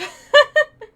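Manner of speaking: a high, loud burst of laughter; sudden laughing fit. Real, not acted. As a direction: let out a big laugh. Loud laugh after giving up.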